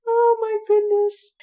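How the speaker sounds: high, slow "oh my goodness"; drawn-out amazed squeal; amazed exclamation. Real, not acted. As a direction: gush it out in delighted amazement. Reacting to the absurd story.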